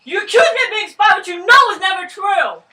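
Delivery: high, loud accusing yell; angry shout. Acted. Jacques yelling his innocence at Max, furious. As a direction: throw the accusation in his face. Acted quarrel between revolutionaries.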